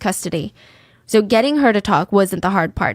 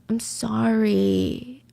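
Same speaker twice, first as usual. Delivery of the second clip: soft, very slow sorry; rehearsed apology; 6 dB quieter, much slower than usual. Retold or imitated. Suspect practising versions of sorry, soft and drawn out. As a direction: stretch it out softly, analysing the tone. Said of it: "one line in different emotions".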